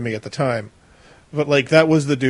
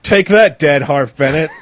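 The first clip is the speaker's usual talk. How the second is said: mock defiant taunt; 7 dB louder than usual. Host shows co-hosts how he'd taunt the dead producer, mock defiant. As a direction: throw it out as a gleeful insult.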